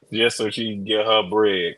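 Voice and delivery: usual speaking voice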